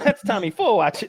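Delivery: a hint of a wiseguy boss; mobster voice. Mobster scolding flashy member.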